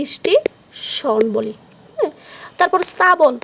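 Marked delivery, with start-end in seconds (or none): 0.1-1.5 স্টেশন said slowly as ইস্টিশন, with an added i before the s; slow prothetic demo. Shown as a demonstration. Teacher showing the class how স্টেশন becomes ইস্টিশন, slowly. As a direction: draw out স্টেশন as ইস্টিশন, a vowel before the cluster. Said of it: "উচ্চারণের সুবিধার্থে কি বলি?".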